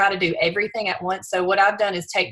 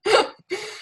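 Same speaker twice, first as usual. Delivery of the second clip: high laugh. Real, not acted. Moved to tears, laughs it off.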